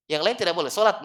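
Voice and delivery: usual speaking voice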